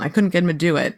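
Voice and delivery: usual speaking voice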